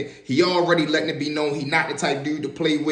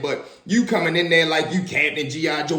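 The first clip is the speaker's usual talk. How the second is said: normal talking voice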